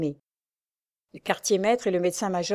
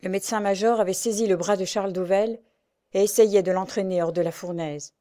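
ordinary delivery

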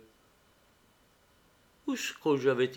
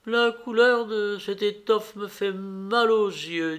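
higher-pitched, slow storybook mother; lightly voiced mother, drawn out and higher; mother's lament; 6 semitones higher than usual. A narrator's reading. Grieving mother excuses her tears.

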